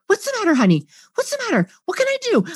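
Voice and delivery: high, over-eager sweet fussing; cheerleader fix-it mode. Retold or imitated. Parent rushing in to fix the child's problem, chirpy. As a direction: rush in to fix everything, chirpy. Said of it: "cheerleader fix-it mode".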